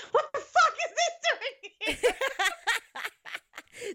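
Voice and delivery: high, slow, laughing disgust; shocked disgust. Retold or imitated. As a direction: gasp it out in horror, laughing. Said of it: "they would have gone".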